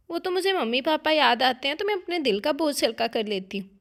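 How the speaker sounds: plain reading voice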